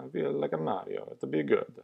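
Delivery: mock Italian plumber accent, barely done; horrible Mario accent. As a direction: toss off a bad cartoon Italian accent. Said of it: "that was the horrible accent".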